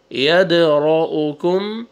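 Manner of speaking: یدرؤکم drawn out slowly, syllable by syllable; slow recitation. Shown as a demonstration. Teacher models the whole word slowly for the class. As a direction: stretch یدرؤکم out carefully. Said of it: "قلقلہ اور را کو موٹا".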